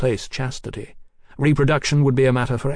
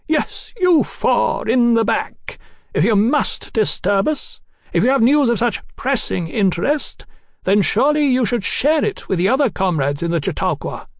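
icy scolding woman; 10 semitones higher than usual. A narrator's reading. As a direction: publicly shame the latecomers, ice-cold. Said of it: "in a voice like an iced razor".

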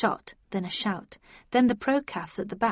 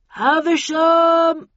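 long, drawn-out high shout; loud, stretched hail from outside; bullhorn call; 8 semitones higher, 9 dB louder, much slower than usual. A narrator's reading. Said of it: "subdued voice on a bullhorn".

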